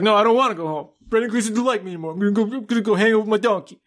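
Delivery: high, fast, whining sulk; a sulking grown man pouting about his donkey; whiny sulking man. Retold or imitated. A man whining to his sister that his friend won't like him.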